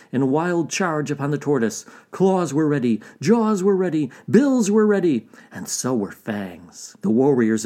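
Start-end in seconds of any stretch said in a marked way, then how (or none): 2.1-5.3 climbing higher and louder with each phrase; building excitement. A narrator's reading. Narrator lists weapons as warriors charge, building excitement. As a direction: stack the phrases, each more urgent.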